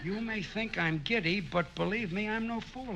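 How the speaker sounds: tries the line over softly to himself; soft repeat of a catchy phrase; quiet musing. Shown as a demonstration. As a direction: murmur it, weighing the words. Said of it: "that line will fit my song".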